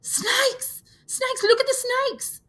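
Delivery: put-on very high, shrill children's scream. Little boys screaming at the others about snakes. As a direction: scream in terror. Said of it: "The little boys screamed".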